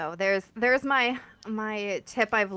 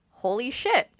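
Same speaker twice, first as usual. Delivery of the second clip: stunned disbelief, pitched up and louder; amazed coworker; 6 semitones higher, 8 dB louder than usual. Retold or imitated. Coworker stunned after the irate call, amazed. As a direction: blurt it out like you can't believe what you just saw. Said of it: "he looks at me and goes".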